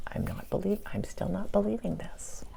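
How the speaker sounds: hushed, breathless disbelief; awed whisper. Real, not acted. Awestruck whisper of disbelief after meeting the queen. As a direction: whisper it as if you still can't believe it.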